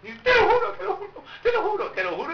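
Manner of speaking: put-on high-pitched pleading kid swearing; hammed-up child insisting in a high voice; lying child. Kid swearing to a lie, high mock pleading. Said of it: "tienen la cosa de que dicen mentiras".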